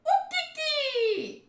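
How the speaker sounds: very high, drawn-out squeal; long squeaky monkey cry; monkey screech. Acted.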